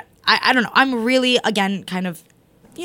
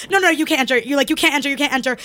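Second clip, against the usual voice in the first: ordinary talk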